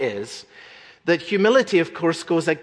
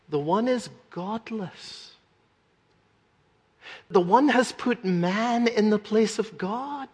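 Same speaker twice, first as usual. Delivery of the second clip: usual speaking voice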